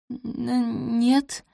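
high, hesitant stammer; faltering. A narrator's reading. Cornered maid denies being at the ball, faltering. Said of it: "пролепетала она".

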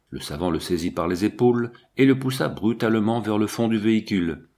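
ordinary delivery, nothing marked

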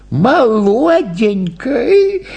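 put-on high, drawn-out old woman's voice. A narrator's reading. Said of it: "сказала старуха". (жалостливо, протяжно, голосом старухи)

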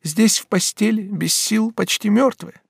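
very high, strained lament; distressed lament. Acted. Batilda laments lying in bed without strength, distressed. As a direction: despair at being helpless.

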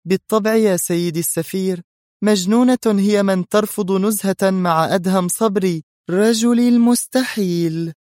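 high, admiring woman's voice; happy high-pitched declaration, slowing at the end; smiling heroine. A narrator's reading. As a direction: answer brightly, drawing out the final title. Beaming heroine answers the ambassador.

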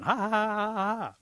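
high, loud mocking gobble; gobbling turkey. Turkey running off with the trophy, gobbling. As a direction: gobble out a triumphant turkey cackle. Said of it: "he's gobbling".

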